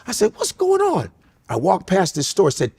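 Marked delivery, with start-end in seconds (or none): 0.4-1.1 high, disbelieving "What's going on?"; a shocked squeal of disbelief; surprised. Retold or imitated. Shocked at same name spelling.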